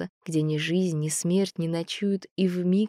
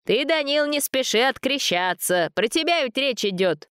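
put-on old man voice; 9 semitones higher than usual. A narrator's reading. Old man speaks behind shoulder.

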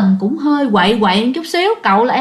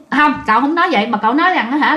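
plain reading voice

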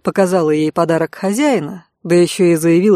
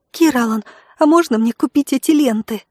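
put-on high, eager young girl; bright girlish exclamation, pitched up; girl's voice; 6 semitones higher than usual. A narrator's reading. (высокий девичий голос)

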